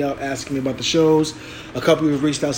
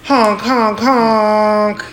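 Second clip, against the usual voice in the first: high, loud honking like a clown horn; silly clown; 6 semitones higher, 6 dB louder, much slower than usual. Pretend. Repeated clown horn honks.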